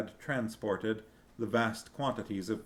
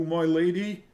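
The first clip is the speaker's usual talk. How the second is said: higher, louder, trembling vow; quavering voice; 8 semitones higher, 7 dB louder than usual. A narrator's reading. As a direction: voice shaking as he pledges. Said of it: "he declared in a quavering voice".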